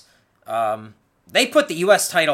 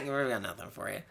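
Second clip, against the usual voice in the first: mock letdown; 9 semitones lower, 13 dB quieter than usual. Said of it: "a guy who's like".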